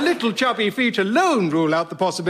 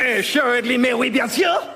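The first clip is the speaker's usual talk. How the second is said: put-on outrageous French accent; 4 semitones higher than usual. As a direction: proclaim it grandly in a thick French accent. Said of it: "in outrageous French accent".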